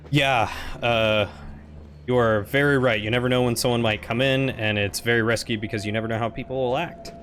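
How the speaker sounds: usual speaking voice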